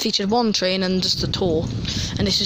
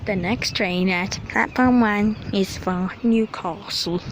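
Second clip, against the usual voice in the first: slow, deliberate imitation of the station announcer; posh Metro announcer; slower than usual. Vlogger mimicking the Metro announcer on the platform, slow posh imitation. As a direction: lightly copy the posh recorded platform voice. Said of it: "you gotta be posh".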